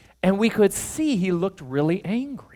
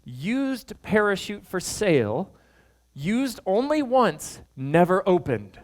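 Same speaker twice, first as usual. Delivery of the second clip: reading the joke ad slowly, with pauses; 3 semitones higher, slower than usual. Retold or imitated. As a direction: spell out the punchline slowly. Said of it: "one of the ads that they submitted said this".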